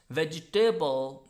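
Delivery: VEGETABLE said "veji-TAY-bul"; VEGETABLE with a full "table" ending; wrong ending on VEGETABLE. Shown as a demonstration. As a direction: make the end of VEGETABLE rhyme with "table". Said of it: "that's incorrect".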